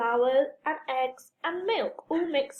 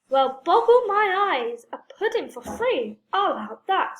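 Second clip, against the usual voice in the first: tinker voice; 4 semitones higher, 5 dB louder than usual. A narrator's reading. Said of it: "said the tinker".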